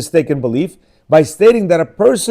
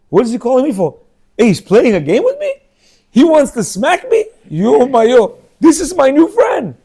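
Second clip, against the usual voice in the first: put-on much higher, louder delighted kid voice; thrilled child; 8 semitones higher, 4 dB louder than usual. Neglected child thrilled the rabbi wants to play, excited. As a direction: play a kid amazed someone notices him.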